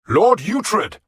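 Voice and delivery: high, loud alarmed command; a king calling a warning; urgent call. Acted. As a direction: stop him before he strikes. King commanding Uhtred to stop.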